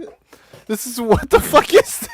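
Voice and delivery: high, incredulous laughing outburst; stunned disbelief. Real, not acted. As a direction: react like you can't believe your eyes. Stunned by image on screen.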